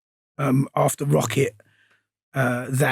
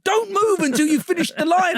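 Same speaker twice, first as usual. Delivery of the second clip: exasperated director; 12 semitones higher than usual. Retold or imitated. Retells the director's order.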